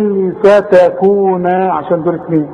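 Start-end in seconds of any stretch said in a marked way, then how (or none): 0.3-1.5 فتكون slowly, ending in a long NAA; full alif. Shown as a demonstration. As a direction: draw out the final vowel of فتكون. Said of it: "عند الوصل وعند الوقف قول".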